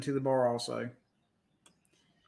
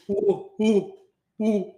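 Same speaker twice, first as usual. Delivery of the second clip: loud, higher creature hooting; imitated Wookiee hoots; 6 semitones higher, 7 dB louder, slower than usual. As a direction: hoot like a Wookiee bartender. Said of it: "this Wookiee comes out".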